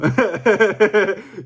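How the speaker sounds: loud, sustained laugh; knowing cackle over an inside joke. Real, not acted. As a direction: crack up at your own tease. Laughs at own teasing.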